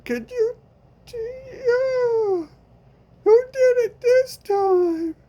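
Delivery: hammed-up sobbing through the pain; mock sobbing wail. Victim begging friend to stop the woman, fake sobbing.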